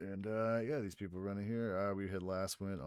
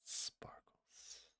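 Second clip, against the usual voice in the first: soft breathy whisper; hushed incantation; whispered spell word; 10 dB quieter than usual. Acted. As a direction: whisper it like a secret charm.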